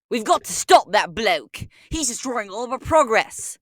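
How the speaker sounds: put-on British accent, taunting the Lorax. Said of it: "British Walrus Detective".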